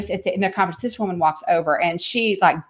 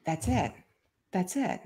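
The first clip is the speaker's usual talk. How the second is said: soft, breathy realization; awed whisper; 11 dB quieter than usual. Real, not acted. Mother realizing her purpose, whispered. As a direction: whisper it as it clicks.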